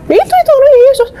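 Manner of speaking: very high retold protest; exasperated insistence. Believer answering opponent about a photo, exasperated insistence.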